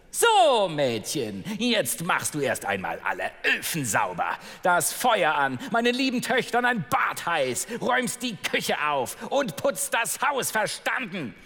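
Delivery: high, bossy stepmother ordering her about; shrill-pitched wicked stepmother barking chores. Acted. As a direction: command the girl like a harsh stepmother. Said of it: "rief die Stiefmutter nach ihr".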